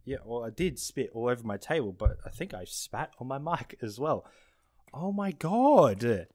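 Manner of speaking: ordinary talk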